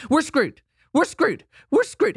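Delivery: mock the husband's frantic wail; Chicken Little panic. Wife imitating her panicking husband, high panicked wail. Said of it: "full-on Chicken Little syndrome".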